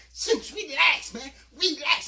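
high, loud, slow relax; mock reassurance. Narrator loudly telling Zeus to relax.

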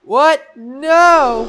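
very high, loud denial; alarmed accused. Acted. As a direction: shout a horrified denial. Voices the accused denying.

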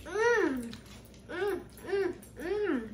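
humming while chewing. Real, not acted. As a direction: mouth full of strawberry. Seen 1: Boy holds bitten strawberry, mouth closed and working while humming.

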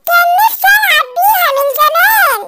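high-pitched toy mimicry; very high cactus toy voice; talking cactus toy. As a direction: repeat it like a squeaky talking toy. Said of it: "الصبارة ردت عليا".

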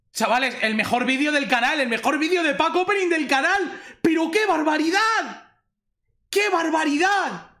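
high-pitched, breathless-with-joy celebration; elated raving. Real, not acted. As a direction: rave to viewers about incredible luck. Raving about the pull just made. (eufórico, con la voz muy aguda)

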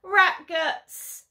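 loud high gleeful yell; howl. Acted. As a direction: howl it out with glee. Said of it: "I howl".

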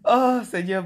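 ordinary talk

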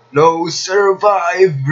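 slow, high, loud proclamation; dramatic battle cry. Pretend. Gamer attacking in a game, drawn-out dramatic cry.

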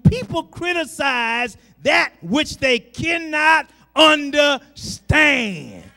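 loud, rising preacher's cry; full-voiced gospel preaching; preaching at full force. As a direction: preach it out to the congregation. Preacher climax.

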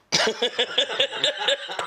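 high wheezing burst of laughter; laughing. Real, not acted. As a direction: crack up at your own story. Laughing at own story.

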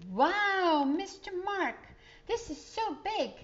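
put-on high, loud wowed kid voice; amazed child. Child amazed by the big olive press, high awed voice. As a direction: gasp it out like a wide-eyed kid.

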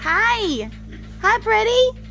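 usual speaking voice

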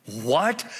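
ordinary talk

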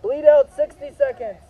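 called out loud and high; loud callout. Real, not acted. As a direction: announce it loud to the field. Medic calling bleed-out time.

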